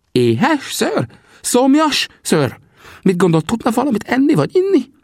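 very high, eager character voice; excited high-voiced questioning; solicitous Sam. A narrator's reading. Sam questions wounded friend.

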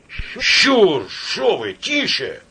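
high urgent shushing; alarmed hushing. A narrator's reading. Companion silencing Shchur in the crowd, alarmed. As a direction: cut him off in alarm.

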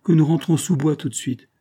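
usual speaking voice

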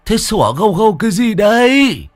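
put-on high, loud drunken scolding; acted high-pitched telling-off; irritated yelling. A narrator's reading. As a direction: holler it in annoyance, last word stretched. Drunk man scolds the shouting others.

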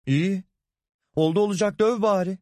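çok tiz, alıngan çıkış; öfkeli meydan okuma. Acted. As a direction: kocana incinmiş halde meydan oku. Kadın kocasına meydan okuyor. (tiz sesle sitemli isyan)

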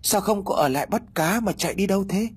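very high, ghostly calling; eerie ghost call. Acted. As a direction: call after him like a spirit. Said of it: "nhiều tiếng gọi để ma quái".

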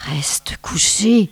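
slow whispered murmur. Acted. Snow child secretly murmuring to the boy, slow whisper. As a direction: breathe it close to his ear, slowly. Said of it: "tout en lui murmurant".

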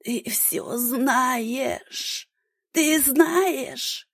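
put-on slow hoarse old woman's rasp rising to a cry. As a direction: wheeze it out, then break high. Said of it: "прохрипев". (хриплый голос умирающей старухи)

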